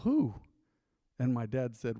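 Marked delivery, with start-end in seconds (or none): 0.0-0.3 surprised who. Retold or imitated. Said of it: "the guy went".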